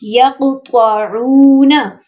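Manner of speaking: यक़्तऊना very slowly, ऊ held long; correct madd. Shown as a demonstration. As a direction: stretch ऊ in यक़्तऊना. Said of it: "सिर्फ मद्दा को लंबा करना है". (यक़्तऊना, ऊ लंबा खींचें)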